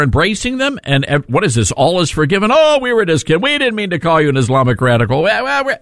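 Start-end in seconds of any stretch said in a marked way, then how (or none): 2.5-5.7 high, fast mock apology. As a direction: sneer it like a fake excuse. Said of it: "it's just a good old joke".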